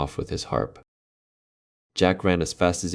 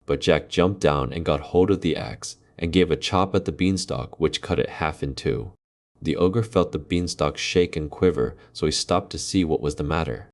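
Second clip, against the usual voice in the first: usual speaking voice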